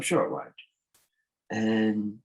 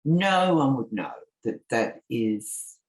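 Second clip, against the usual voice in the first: usual speaking voice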